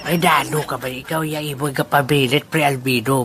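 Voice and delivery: ordinary talk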